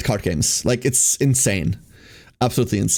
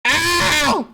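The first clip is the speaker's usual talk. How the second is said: high pained yelps from a bitten tongue; just bit tongue; much slower than usual. Real, not acted. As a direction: yelp in real pain, bitten tongue. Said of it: "I bit it twice".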